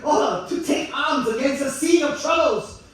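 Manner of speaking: high, loud theatrical declamation; hammed-up Shakespeare. Comedian mocks Shakespeare for the audience, high loud declaiming. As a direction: declaim it like an overblown Hamlet. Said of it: "Because Shakespeare always got skull one".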